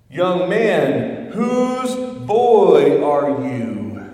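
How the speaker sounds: booming drawn-out repeat of the question; preacher calling again. Acted. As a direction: ask it again, leaning in harder. Preacher asks again, louder.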